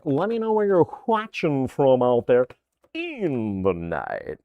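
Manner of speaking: smooth late-night radio host, drawn out. Pretend. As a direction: play a night-show DJ greeting listeners. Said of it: "I don't know why I always get the radio voice".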